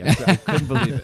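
can't believe the win, burst out laughing; laughing with joy. Real, not acted. Won the game show, overjoyed.

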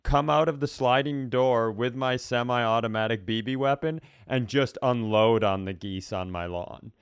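ordinary delivery

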